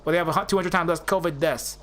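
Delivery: hammed-up New York wiseguy accent. A mock boss laying down the law to doctors in a New York accent. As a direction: pile on the New York, amateurishly. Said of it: "how much more New York I can put into that accent".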